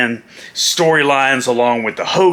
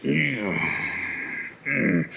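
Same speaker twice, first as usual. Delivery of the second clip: low Macho Man hum; 11 dB quieter than usual. Shown as a demonstration. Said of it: "every now and then he goes".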